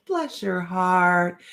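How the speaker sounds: slow, stretched Bless your heart; drawn-out Southern put-down. Shown as a demonstration. Host explains the Southern curse, stretching the phrase slowly. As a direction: draw it out slowly like a sweet insult. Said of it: "it is the Southern way of cursing you".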